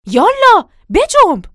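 high, very loud order; explosive, high-pitched hustle; urgent shout. Acted. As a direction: yell to hurry him up. Urging servant up the ladder.

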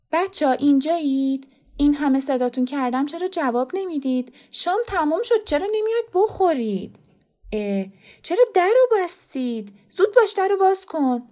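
put-on high, loud call to the kids behind a locked door; calling through the door. Fereshteh calls the children to dinner through the locked door, high and loud. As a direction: raise your voice, high and pushy, as if knocking.

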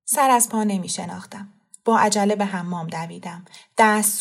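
ordinary delivery, nothing marked

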